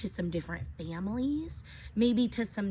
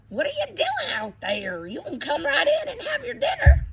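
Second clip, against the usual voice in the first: high, playful, louder grandpa voice with a laugh; voicing Grandpa Poppy; 7 dB louder than usual. Reading to kids, Poppy teases the child outside. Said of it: "Poppy says".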